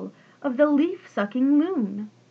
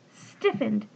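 plain reading voice

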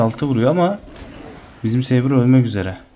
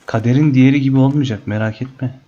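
coaxing; 3 semitones higher than usual. Real, not acted. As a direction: sweet-talk the animal to sleep. Soothing the creature to faint.

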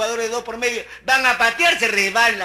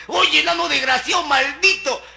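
angry gringo insult; 8 semitones higher than usual. Retold or imitated. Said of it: "le decía".

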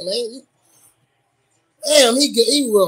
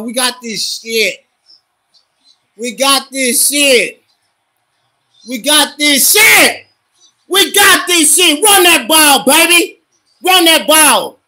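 slow chant rising to high, loud cheering; building rally cry; 4 semitones higher, 4 dB louder, slower than usual. Real, not acted. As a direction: start steady, build to a fired-up yell.

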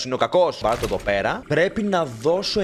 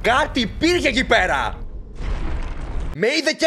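startled; 10 semitones higher than usual. Real, not acted. Startled by something in the dark.

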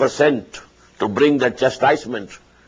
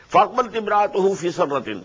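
rapid recitation, pitched higher; raised quick recitation; 6 semitones higher, much faster than usual. Acted. Teacher reciting the verse about Sarah. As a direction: recite the verse fast with a lifted voice. Quran recitation in tafsir lesson.

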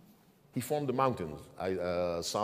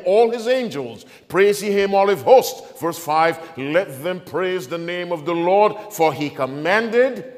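loud, raised preacher's voice, ringing out verses; full-force preaching; 5 semitones higher, 11 dB louder than usual. Real, not acted. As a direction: proclaim the psalm to the whole church.

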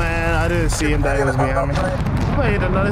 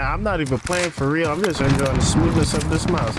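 ordinary delivery

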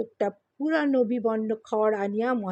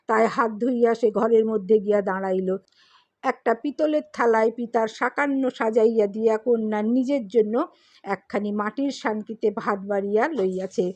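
ordinary delivery, nothing marked

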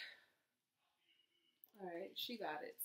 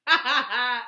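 loud burst of laughter; 4 semitones higher, 18 dB louder than usual. Real, not acted. Laughs at being called loud.

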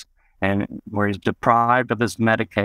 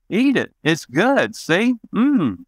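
much higher, sing-song coaxing voice; coaxing dad; 10 semitones higher, slower than usual. Retold or imitated. Dad coaxing his little kid to try the tuna, high sing-song. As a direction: sweetly urge a kid to take a bite.

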